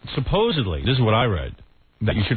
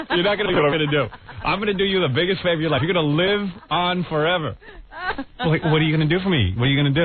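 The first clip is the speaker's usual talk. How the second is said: lightly acted higher-pitched boastful husband; eager husband's pitch; 6 semitones higher than usual. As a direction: sell the grand favor to your wife. Acts man naming it for his wife.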